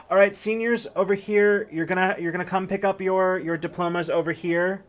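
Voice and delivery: high, loud principal call; principal announcing. Acted. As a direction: call the seniors up. Principal calls seniors on stage.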